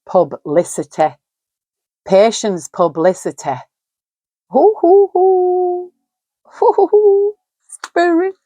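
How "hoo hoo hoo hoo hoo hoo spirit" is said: high, ghostly hoo hoo hoo; eerie high owl hoots to summon a spirit; high hooting call. Acted.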